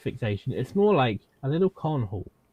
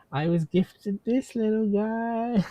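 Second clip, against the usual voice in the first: high slow reveal; 10 semitones higher, slower than usual. Real, not acted.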